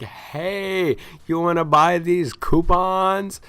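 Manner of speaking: high, drawn-out calling voice; half-yell it so it carries through glass. Retold or imitated. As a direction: call out slowly, pitched high. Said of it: "half yelling".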